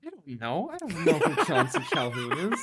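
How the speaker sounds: laughing through the words. Real, not acted. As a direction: giggle while admitting you don't know. Stumped, laughing at trivia question.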